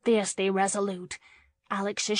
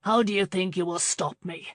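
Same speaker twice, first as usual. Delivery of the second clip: ordinary delivery, nothing marked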